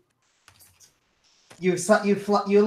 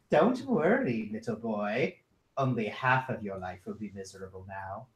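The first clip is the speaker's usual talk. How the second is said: low, soft, cruel taunt; menacing creature whisper; 10 semitones lower, 10 dB quieter than usual. Acted. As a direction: hush it like a fiend mocking a child. GM voices Erinyes taunting child.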